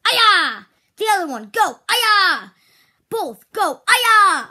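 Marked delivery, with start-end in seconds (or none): 0.1-0.6 loud, high shouted yeah; kiai shout. Shown as a demonstration. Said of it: "when I say any of those, go". 3.9-4.5 loud, high shouted yeah; kiai shout. Shown as a demonstration. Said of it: "when I say any of those, go".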